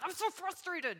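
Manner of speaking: high, whining I'm so frustrated; whiny complaint. Retold or imitated. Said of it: "everything came out of my mouth".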